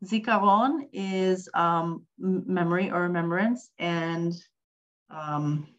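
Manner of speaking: ordinary delivery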